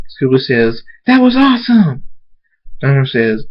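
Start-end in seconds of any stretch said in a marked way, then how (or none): 1.0-2.0 high, loud excited squeal; thrilled. A narrator's reading. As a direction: burst out in awe. Child thrilled by the fight.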